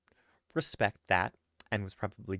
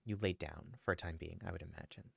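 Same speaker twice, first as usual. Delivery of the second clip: story narration; 3 semitones lower, 8 dB quieter than usual. Acted. GM narrates Squomo's scene.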